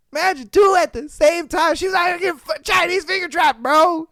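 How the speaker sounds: high, loud, incredulous shout; excited disbelief. Real, not acted. Still laughing incredulous.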